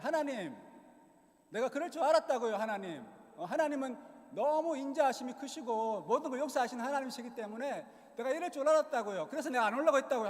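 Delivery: loud, high-pitched indignant complaint; angry protest. Retold or imitated. Jonah complaining to God over sparing Nineveh, angry protest. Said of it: "막 삿대질하면서 이렇게 얘기하는 거예요".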